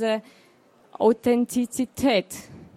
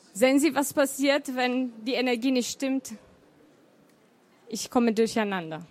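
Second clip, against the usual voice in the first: normal talking voice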